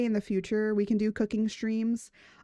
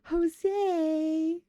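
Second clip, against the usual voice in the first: high, pleading call of a name; whiny plea; 8 semitones higher than usual. Retold or imitated. Begging partner Jose for merch, high whiny plea. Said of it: "I always just go".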